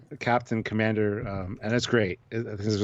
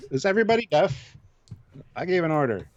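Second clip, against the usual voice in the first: impatient captain; 5 semitones higher than usual. Retold or imitated. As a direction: play an exasperated captain barking orders. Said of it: "Picard's like".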